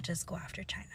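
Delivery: hushed, breathy whisper; conspiratorial whispered question. Acted. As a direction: lean in and whisper it like a secret. Repeats the question, then whispers it.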